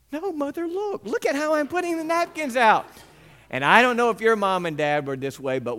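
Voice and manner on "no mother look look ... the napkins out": put-on high, whiny kid's plea; pleading child. Boy begs his mother to let him still go, high whiny plea. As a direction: beg mom like a desperate boy.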